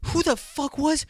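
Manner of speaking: high, gushing fake concern; mock shocked. Guy pretending shock to the punched girl, high mock concern. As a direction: act appalled, playing the hero. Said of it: "You'd be like".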